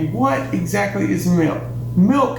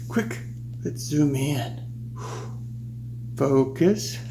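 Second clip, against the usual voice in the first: normal talking voice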